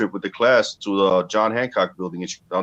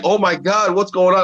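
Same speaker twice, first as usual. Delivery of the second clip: startled mother; 8 semitones higher than usual. Retold or imitated. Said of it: "the mother's like".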